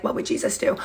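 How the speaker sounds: breathy whisper of the slogan; hushed whisper. Retold or imitated. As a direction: whisper it like a half-joking aside. Bracelet slogan quoted aside.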